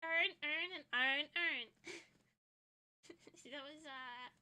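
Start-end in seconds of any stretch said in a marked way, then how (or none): 0.0-1.7 loud, repeated "earn" testing the vowel; accent test word. Shown as a demonstration. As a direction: drill the word "earn" again and again. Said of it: "those accent tests".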